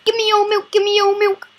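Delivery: put-on high, loud demanding baby; loud high baby demanding milk. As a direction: whine like a hungry baby. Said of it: "The baby on the bus goes".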